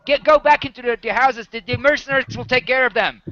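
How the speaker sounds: loud, high-pitched warning shout. Acted. Militia member sending villagers back into their houses, shouting. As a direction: shout orders to a scattered crowd. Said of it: "you can hear him shouting".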